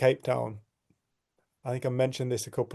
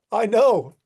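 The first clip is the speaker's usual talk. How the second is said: bursting out high and loud, beaming; joyful I know; 8 semitones higher, 10 dB louder than usual. Retold or imitated. Ben to Dave, sure of his worth: beaming loud reply. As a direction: belt it out with a huge smile. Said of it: "and he went".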